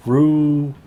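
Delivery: normal talking voice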